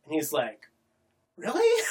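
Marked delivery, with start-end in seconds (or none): 1.4-1.8 much higher, laughing disbelief; a delighted, unbelieving squeak; surprised really. Retold or imitated. Hero surprised she loves him.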